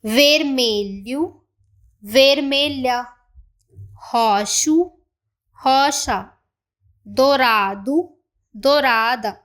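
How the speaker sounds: slow model words for the class to echo; each color in both forms, clear; repeat drill. Shown as a demonstration. Teacher repeats the colors for students to copy. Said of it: "मैं फिर से repeat कर रही हूँ".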